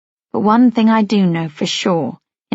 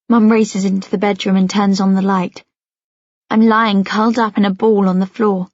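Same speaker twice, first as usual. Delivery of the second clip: usual speaking voice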